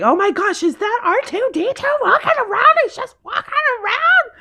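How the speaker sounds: put-on young daughter thrilled at seeing a walking robot; excited daughter. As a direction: gush like a child seeing a robot. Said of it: "she was like".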